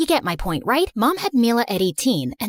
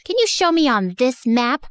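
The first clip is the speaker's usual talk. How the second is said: ordinary delivery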